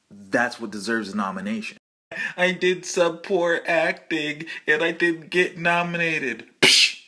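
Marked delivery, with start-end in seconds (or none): 2.4-6.5 mock whiny actor. Mocking whiny actor quote. 6.6-7.0 scornful scoff. Acted. Scoffing at the quote.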